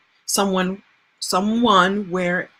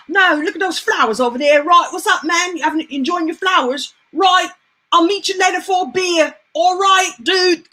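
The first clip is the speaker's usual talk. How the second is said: put-on higher, loud Brixton London accent; London British accent; 8 semitones higher, 3 dB louder than usual. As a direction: play a chummy London bloke, British accent. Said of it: "he has an accent".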